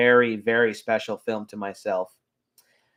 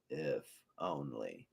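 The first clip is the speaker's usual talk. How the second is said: much softer, slow near-whisper; whispered echo; 11 semitones lower, 19 dB quieter, slower than usual. Retold or imitated. Hades repeating his wish to himself, near whisper. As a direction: trail off into a bitter murmur. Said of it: "so hilarious and so quotable".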